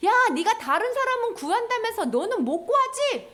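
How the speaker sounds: high, swooping taunt; mocking jeer. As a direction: taunt the helpless man. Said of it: "이렇게 조롱했어요".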